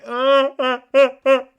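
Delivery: loud, high fake sobs in bursts; hammed-up wailing sob; mock sobbing. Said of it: "And starts sobbing".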